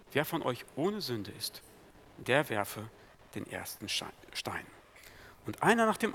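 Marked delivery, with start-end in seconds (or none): 0.1-4.7 hushed repeat. Acted. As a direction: lower and softer, fading to a whisper on the last words. Same quote repeated, fading down.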